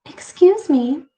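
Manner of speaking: put-on bright old woman. A narrator's reading. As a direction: chirp it sweetly. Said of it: "she said brightly".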